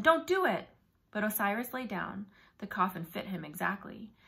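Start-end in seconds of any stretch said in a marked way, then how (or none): 0.0-0.7 higher, louder pleading cry; urgent cry. A narrator's reading. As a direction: beg him to stop. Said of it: "I thought again".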